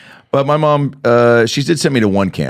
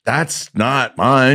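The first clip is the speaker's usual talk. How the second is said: slow, halting mock denial; retold denial; 4 semitones higher, much slower than usual. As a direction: deny it word by word, drawn out. Retold feeble denial at camp.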